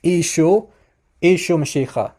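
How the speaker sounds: "Ishu Mashiach" as EE-shoo muh-SHEE-kha; Eastern dialect pronunciation. Shown as a demonstration. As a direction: say "Ishu", then "Ishu Mashiach" with a throaty kh and final ah. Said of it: "name of Jesus being pronounced as".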